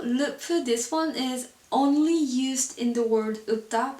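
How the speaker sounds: ordinary delivery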